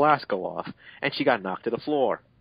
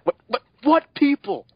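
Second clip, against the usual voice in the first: much higher, louder, slow incredulous question; baffled disbelief; 14 semitones higher, 7 dB louder than usual. Real, not acted. Confused by the story's surprise people, incredulous. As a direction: stumble in comic disbelief. Baffled by nonsensical fanfic detail.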